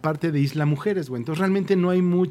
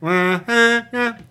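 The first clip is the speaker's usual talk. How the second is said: high, loud, drawn-out vendor call; street vendor shout; 4 semitones higher, 6 dB louder, much slower than usual. Retold or imitated. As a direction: bellow it out like a street seller. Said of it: "un señor que me gritaba".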